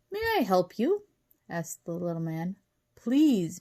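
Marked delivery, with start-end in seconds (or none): 0.1-1.0 high, lilting polite voice; courteous little man. A narrator's reading. Little man to twins at his door, high and courteous. 2.9-3.6 loud, higher-pitched plea; begging. A narrator's reading. Wally begs the little man at the door, pleading. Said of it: "begged Wally".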